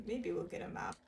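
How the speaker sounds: ordinary delivery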